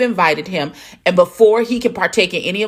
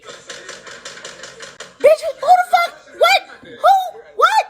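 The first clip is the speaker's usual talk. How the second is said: high laughing disbelief; shocked squeal. Real, not acted. Watching Carl bang on the door, shrieking with laughter.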